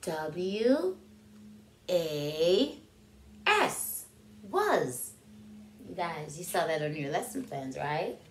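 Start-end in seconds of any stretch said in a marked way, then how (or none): 0.0-4.1 letters W, A, S said one by one; spelling out. Shown as a demonstration. Teacher spelling the word was for kids. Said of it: "look at that word was".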